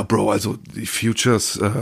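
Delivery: ordinary talk, nothing marked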